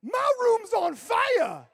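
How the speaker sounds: high, loud, slow scream; panicked yell. Retold or imitated. Woman fleeing her room to the front desk, panicked scream. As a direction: shriek it like the room is burning. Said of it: "and yells".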